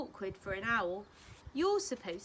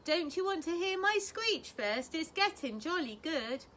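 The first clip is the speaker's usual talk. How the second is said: eager owlet; 6 semitones higher, 4 dB louder than usual. A narrator's reading.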